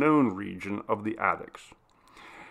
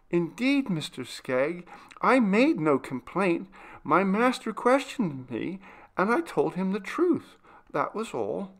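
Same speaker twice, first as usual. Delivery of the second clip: tearful pleading; 8 semitones higher than usual. Acted. Maria, weeping, defending herself to the butler. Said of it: "weeping silently over her work".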